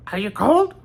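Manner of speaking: very high, loud call; high-pitched put-on voice, loud; Bobby Justin. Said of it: "do a Bobby Justin impression".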